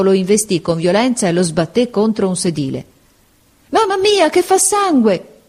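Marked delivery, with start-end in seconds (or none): 3.7-5.4 put-on very high, drawn-out girl's scream; alarmed cry. A narrator's reading. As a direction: cry out in horror at blood. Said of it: "gridò la ragazza".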